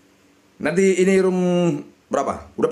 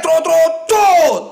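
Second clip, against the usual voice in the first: loud, high chant of trot; rising hyped chant; excited shouting; 10 semitones higher, 7 dB louder, much slower than usual. Real, not acted. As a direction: urge the reels on at full voice. Cheering reels on slot spin.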